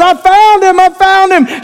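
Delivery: high, loud cry of joy; triumphant call. Retold or imitated. Pastor calling to the neighbor that he found the dog, high and joyful.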